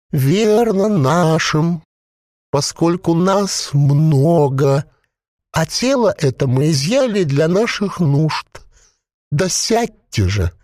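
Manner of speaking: high, slow gurgling creature voice; a possessed corpse bubbling out its words. A narrator's reading. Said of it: "Звук его голоса напоминал бульканье маслянистого варева".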